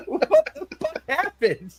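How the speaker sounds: very high, laughing question; laughing disbelief. Real, not acted. Joking about an absurd movie ending, laughing.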